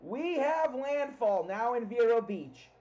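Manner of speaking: loud, slow landfall announcement; yelling over hurricane winds. Real, not acted. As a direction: shout it out, drawn out.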